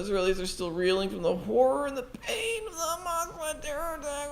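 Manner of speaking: high, crying mock newsreader voice; mock sad reading. Streamer reads the news article in a mock weeping voice. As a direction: wail the news like a weepy anchor. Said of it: "this particular reading that I'm doing".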